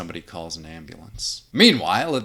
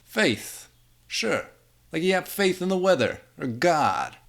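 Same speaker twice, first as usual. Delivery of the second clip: put-on high, scornful old man; scoffing old man; 9 semitones higher, 5 dB louder than usual. Old man scoffing at his friend's faith, higher.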